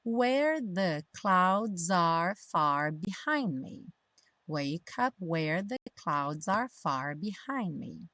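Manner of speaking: slow, drawn-out model line; pronunciation practice. Shown as a demonstration. Teacher leading learners through lyrics, slow pronunciation practice. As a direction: stretch out each linked phrase. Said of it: "pra prática da pronúncia".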